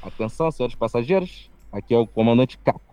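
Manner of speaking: low, calm airline captain announcement; pilot cockpit headset. Retold or imitated. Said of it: "botei o meu por cima com voz de piloto".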